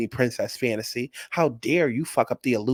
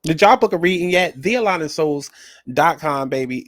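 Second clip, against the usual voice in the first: ad plug; 2 semitones higher, 5 dB louder than usual. Real, not acted. Plugging her business.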